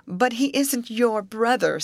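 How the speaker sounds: slow, high, pointed comeback; retort. A narrator's reading. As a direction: throw it back with emphasis. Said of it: "said Grace in retort".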